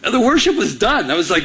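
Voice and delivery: ordinary talk, nothing marked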